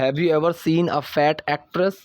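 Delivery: slow model sentence. Shown as a demonstration. Teacher models slow English line.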